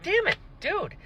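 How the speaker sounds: high, soft dismay; exasperated. Retold or imitated. Reader finds Lirin is awful, high exasperation. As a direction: groan it out in disappointment. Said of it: "And I was like".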